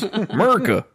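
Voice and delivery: proud American shout; patriotic cheer. Pretend.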